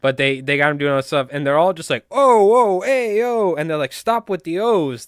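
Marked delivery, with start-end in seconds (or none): 2.1-3.5 loud, high mobster ohs; hammed-up wiseguy commotion; imitated Italian mobsters. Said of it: "these, like, Italian mobsters". 3.9-5.0 slow, higher mock mobster rebuke; a hint of irritated wiseguy; imitated Italian mobster. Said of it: "these, like, Italian mobsters".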